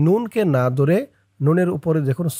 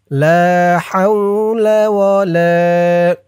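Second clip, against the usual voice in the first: slow, louder chanted recitation; 4 dB louder, much slower than usual. Shown as a demonstration. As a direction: draw out লা হাওলা with a full voice. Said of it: "আমি যেমনভাবে উচ্চারণ করব".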